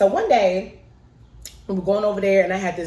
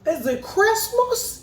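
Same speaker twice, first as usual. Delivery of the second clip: high, slow, wide-eyed gasp of a question; stunned disbelief; 13 semitones higher, much slower than usual. Retold or imitated. Little girl asking her mom, high, slow and amazed. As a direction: drag it out like a kid who can't believe it. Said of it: "And I was like".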